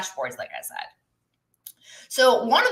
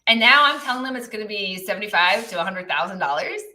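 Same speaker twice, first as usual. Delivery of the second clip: ordinary talk, nothing marked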